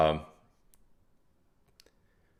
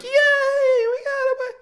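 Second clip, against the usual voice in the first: high, slow, squealing mock cheer; much slower than usual. Mocking customers who cheer that the banks are fixed, squealing. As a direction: squeal it like a gullible fan. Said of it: "Everyone's like".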